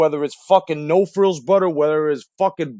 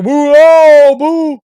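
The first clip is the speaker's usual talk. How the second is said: high, loud crowd whoop and boo; mock crowd booing; 11 semitones higher, 10 dB louder, much slower than usual. Host imitating the crowd's boos at the video. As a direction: shout it like a jeering crowd. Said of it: "the crowd was like".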